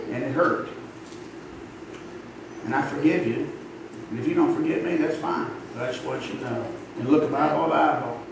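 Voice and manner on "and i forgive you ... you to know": normal talking voice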